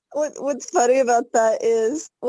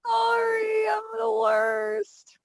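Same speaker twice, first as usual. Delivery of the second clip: much higher and slow, overdone remorse; hammed-up apology; 11 semitones higher, slower than usual. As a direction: wail a fake apology. Apologising for stealing the pick.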